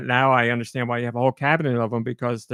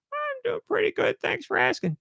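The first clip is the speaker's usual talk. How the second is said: much higher cheerful little voice; squeaky George voice; 4 dB quieter than usual. Acted. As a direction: pitch way up into a happy squeaky character. Host voices George figure.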